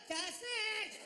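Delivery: "just next" high and mashed together; mimicked garbled "just next"; slurred counter call. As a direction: squawk "just next" as one word. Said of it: "she was barely trying to speak".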